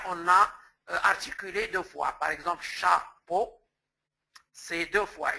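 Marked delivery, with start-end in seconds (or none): none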